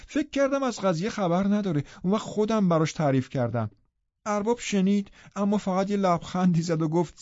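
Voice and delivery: a nosy housemaid spilling village gossip; Zillah gossiping. A narrator's reading.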